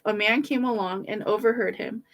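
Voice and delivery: usual speaking voice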